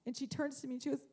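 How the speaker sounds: ordinary delivery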